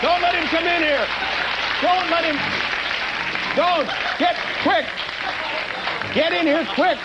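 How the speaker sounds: flee in comic terror; panicked. Pretend. Panics as Richard Simmons arrives.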